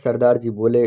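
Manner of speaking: plain reading voice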